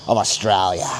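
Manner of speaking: Australia said the Australian way. Shown as a demonstration. To viewers, showing how locals say Australia, Australian accent. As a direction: demo the Australian pronunciation of Australia. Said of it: "Australian way of saying it".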